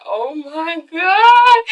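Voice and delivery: slow, rising, tearful cry; overwhelmed wail. Acted. Girlfriend watching him kneel, overwhelmed rising wail. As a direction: stretch it out into an acted wail.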